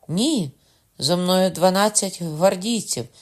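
loud answer. A narrator's reading. The countess loudly explains her escort. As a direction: call it out plainly.

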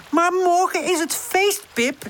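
high, drawn-out plea; pleading. A narrator's reading. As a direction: beg your friend to give in. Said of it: "zegt Woezel smekend".

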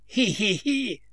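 squeaky mock tittering; a quick hammed-up hee-hee-hee; imitated old man's giggle. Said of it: "всё верещит".

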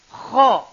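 Kho rasped hard at the top of the throat; upper throat kho. Shown as a demonstration. Teacher showing students the top throat letter, rasping demo. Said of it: "tenggorokan paling atas".

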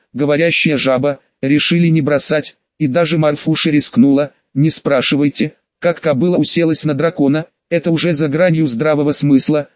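ordinary delivery

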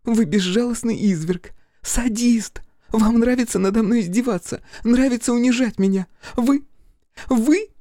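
high-pitched tirade climbing to the end; tearful outrage. Acted. Fiona rails at Crow until he kisses her, tearfully outraged.

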